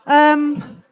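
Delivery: ordinary talk, nothing marked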